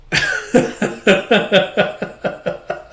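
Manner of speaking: breathy run of chuckles; real laugh. Real, not acted. Reader amused by the father-daughter scene, real laughing. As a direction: laugh in quick wordless bursts.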